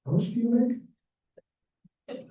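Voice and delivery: slow, high, drawn-out mercenary question; mock gold-digger. Talking to George, a hammed-up greedy, slow, high question. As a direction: ham up the greedy fiancée asking, slowly. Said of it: "Not very romantic, right?".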